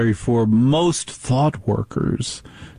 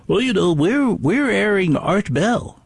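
mock radio executive; 7 semitones higher than usual. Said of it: "One of the clowns on the call said".

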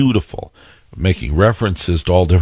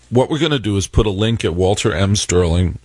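usual speaking voice

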